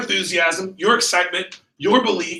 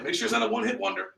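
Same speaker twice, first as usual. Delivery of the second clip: quick, higher-pitched encouragement; engaged salesman; 4 semitones higher, 7 dB quieter, faster than usual. Shown as a demonstration. Trainer showing the right demo to reps, engaged. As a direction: briskly egg the customer on. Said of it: "So when they cut through that rope".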